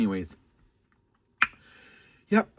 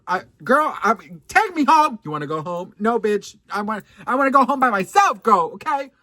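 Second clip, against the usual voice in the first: high, loud exasperated outburst; loud demand; 9 semitones higher, 12 dB louder than usual. Retold or imitated. Telling the date to take her home, loud. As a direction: insist on going home, fed up.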